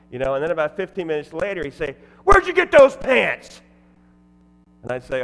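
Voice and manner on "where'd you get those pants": high, drawn-out heckling yell; gruff shout. Retold or imitated. Gruff man heckling the MC about his pants, loud gruff shout. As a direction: bellow it slowly at the MC. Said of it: "he was kinda gruff".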